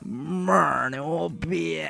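slow, lips turned down at the corners; mouth corners pulled down. Shown as a demonstration. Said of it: "这妹儿得把那个嘴角往下撇".

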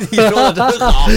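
laughing. Real, not acted.

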